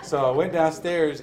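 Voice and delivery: chuckling through the words; laughing. Real, not acted. Recounting his basement, laughing. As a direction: laugh as you tell it.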